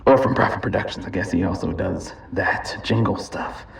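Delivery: ordinary delivery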